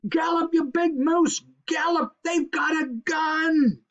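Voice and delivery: high, urgent squirrel yell; high-pitched frantic warning. A narrator's reading. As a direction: yell it to make him run.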